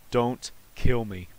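slow, spaced-out words; measured begging, "Don't" set apart; deliberate plea. Real, not acted. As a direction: plead slowly, pausing after "Don't". Repeats the plea word by word.

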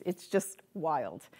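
usual speaking voice

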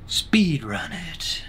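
slow, voiceless whisper; drawn-out whisper. Pretend. Player boasting before a speedrun, slow whisper. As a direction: whisper it, stretching the words out.

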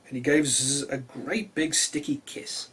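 ordinary delivery

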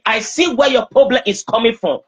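ordinary delivery

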